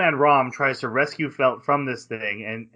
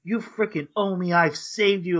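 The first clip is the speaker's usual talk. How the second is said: mock rant; 6 semitones higher than usual. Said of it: "he starts ranting".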